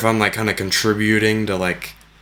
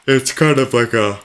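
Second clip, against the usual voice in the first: talking through a yawn; words stretched by a yawn; 3 semitones higher, 4 dB louder than usual. Real, not acted. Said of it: "excuse me for yawning".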